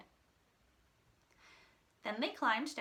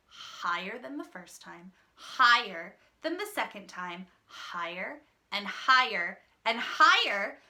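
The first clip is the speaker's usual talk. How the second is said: building excitement; 8 dB louder than usual. A narrator's reading.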